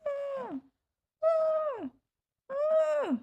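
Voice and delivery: high, loud whining yelps; imitated dog whine. Imitating Maisie whining for cookies, high and loud. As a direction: copy the dog begging for cookies. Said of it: "She's just gonna go".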